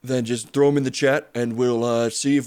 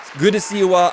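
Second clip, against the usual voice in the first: same greeting again, higher and louder, punching good; bigger greeting; 6 semitones higher, 4 dB louder than usual. Acted. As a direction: belt out the welcome like a TV host.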